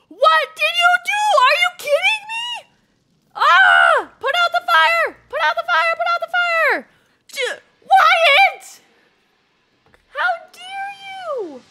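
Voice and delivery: high, loud shouts of outrage; panicked yelling. Real, not acted. Player yelling at Wyatt over the fire, panicked shouting. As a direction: shriek at them in alarm.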